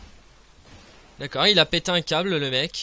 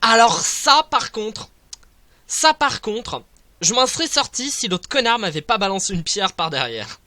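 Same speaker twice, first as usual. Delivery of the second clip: indignant rant; 6 semitones higher, 5 dB louder than usual. Real, not acted. Complaining after death.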